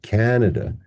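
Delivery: "Canada" as CAN-uh-duh; weight on CAN in "Canada"; stressed first syllable. Shown as a demonstration. Teacher contrasting two words, "Canada" stressed on CAN. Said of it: "In contrast to this one".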